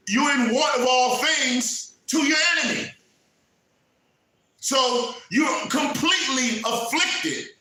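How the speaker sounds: high, loud fiery sermon with shouted lines; preaching at full force. Acted. Preacher rebuking a complacent congregation, shouting.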